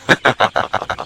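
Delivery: a short breathy snicker; hushed cruel snicker; breathy mocking laugh. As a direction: laugh under your breath at her. Soldier laughs at her fate.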